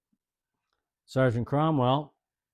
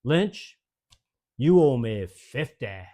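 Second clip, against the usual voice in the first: sergeant's order; 5 dB louder, slower than usual. Retold or imitated. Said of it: "looks at me and goes".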